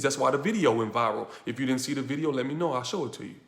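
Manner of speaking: normal talking voice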